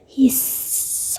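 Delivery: a slow drawn-out hiss of shh; soft unvoiced shush. Real, not acted. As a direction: shush them softly. Shushing as map loads. (هیس کشیده و آرام)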